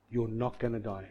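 low, soft, steady promise; quiet reassurance. Retold or imitated. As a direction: reassure him gently, voice dropped. Mum reassures her dying son.

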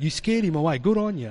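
normal talking voice

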